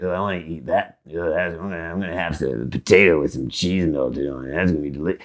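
low, quiet talking to yourself; imagined food musing. Acted. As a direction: murmur a food craving down low. Imagined musing about food, acted.

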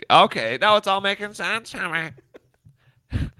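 lightly voiced prim nerd, high; a hint of a prissy know-it-all; nerdy teacher's pet voice. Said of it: "I hate that voice".

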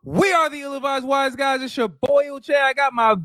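high, sustained hype-man opening with rapid-fire yo's; hype show intro. Acted. Host introducing the show and his boy, hyped.

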